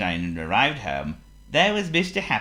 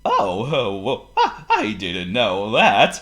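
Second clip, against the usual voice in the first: loud, slow words through chuckles; jolly swooping laughter; Mister Happy laughing; 3 semitones higher, 7 dB louder, slower than usual. Acted. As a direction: get the joke and laugh while speaking.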